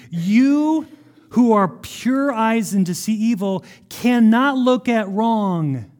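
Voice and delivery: loud, slow pleading prayer to God; impassioned prayer. Retold or imitated. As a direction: cry out to God, slow and earnest.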